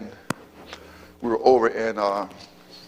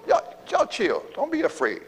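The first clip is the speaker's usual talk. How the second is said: much higher, softer, calm reassurance; David reassuring; 10 semitones higher, 3 dB quieter than usual. Pretend. David telling the soldiers to chill, high and soft. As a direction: settle them down gently in a high voice. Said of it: "He told him".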